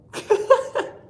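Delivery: squealing laugh up high. Real, not acted. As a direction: laugh in delight. Laughing at summoning viewer.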